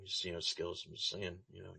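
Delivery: fading into a whispered aside; trailing into a whisper. Real, not acted. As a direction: drop to a whisper at the end. Teasing jab, then muttered aside.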